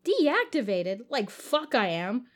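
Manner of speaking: loud, indignant outburst; angry disbelief. Acted. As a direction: refuse it, outraged. Jake defies the AI.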